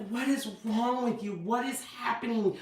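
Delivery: bewildered but gentle questioning; not being mean. Retold or imitated. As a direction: imitate a baffled husband, quietly pleading. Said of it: "not being mean, but he's like".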